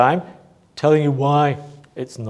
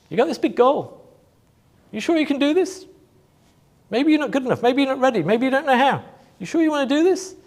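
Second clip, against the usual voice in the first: higher, softer taunting voice of doubt; doubting inner voice; 7 semitones higher, 5 dB quieter, faster than usual. Acted. Speaker voicing the paradigm's doubts to the audience, a higher, softer taunt. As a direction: needle yourself with doubt, pitched up.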